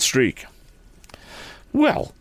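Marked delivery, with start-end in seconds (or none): 1.7-2.1 high-pitched indignant outburst; cross and high; angry cry. A narrator's reading. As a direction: confront them crossly. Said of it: "cried Tom angrily".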